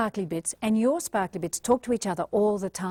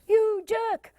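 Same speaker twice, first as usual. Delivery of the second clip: mock insult; 10 semitones higher than usual. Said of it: "if you do things like".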